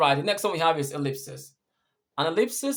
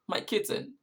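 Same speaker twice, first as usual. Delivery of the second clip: higher, breaking little lament; mock sobbing; 6 semitones higher, 4 dB quieter than usual. Teacher imitates the sobbing little boy, mock sobbing. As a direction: lightly act a crying child's halting words. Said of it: "when the baby is sobbing".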